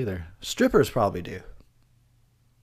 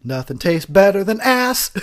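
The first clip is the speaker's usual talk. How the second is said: mock slogan; 7 semitones higher, 7 dB louder than usual. Mock slogan, doing character voice.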